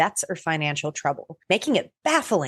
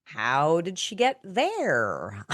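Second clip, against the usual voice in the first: slow mock mystery; much slower than usual.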